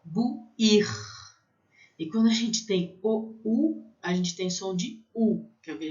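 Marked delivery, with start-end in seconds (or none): 0.0-0.9 bouillir with a long i at the end; French bouillir. Shown as a demonstration. Teacher showing long i, French pronunciation. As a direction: stretch the i in bouillir. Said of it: "fica um I comprido".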